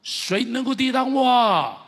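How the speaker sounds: slow, loud, rising declaration; preaching proclamation. Acted. Preacher to congregation, a slow ringing declaration. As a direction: proclaim it to the whole hall.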